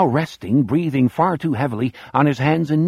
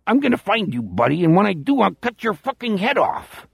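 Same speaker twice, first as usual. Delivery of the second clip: Chicago voice; 7 semitones higher than usual. A narrator's reading. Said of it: "In his Chicago voice".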